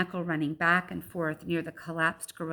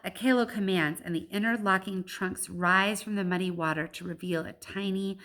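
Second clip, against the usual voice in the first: usual speaking voice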